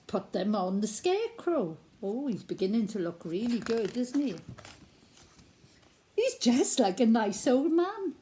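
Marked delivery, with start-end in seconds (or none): none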